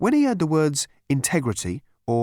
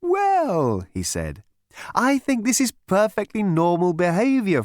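much higher, sing-song soothing voice; chirpy psychiatrist; 6 semitones higher than usual. A narrator's reading. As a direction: purr reassurance to a violent patient.

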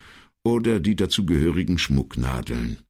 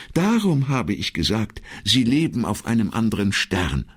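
lightly voiced secretary, higher in pitch; earnest secretary; 6 semitones higher than usual. Secretary concludes her case to the commissario, earnest.

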